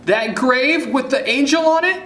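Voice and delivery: loud, high, urgent question; excited child's high-pitched yell. A narrator's reading. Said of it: "you yell".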